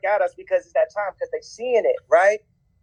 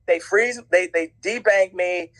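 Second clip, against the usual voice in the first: ordinary delivery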